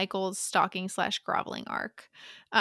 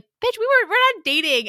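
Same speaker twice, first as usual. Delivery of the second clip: higher, louder snapping retort; angry outburst; 10 semitones higher, 7 dB louder than usual. Retold or imitated. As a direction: blast him with sudden anger. Acted quarrel with PJ.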